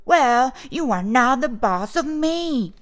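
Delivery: defiant retort. Acted. Boy retorting to girl, defiant. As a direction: hit back harder, emphatically. Children's acted quarrel, retort.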